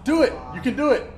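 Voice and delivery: high loud eager urging. Real, not acted. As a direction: egg him on loudly. Urging friend loudly.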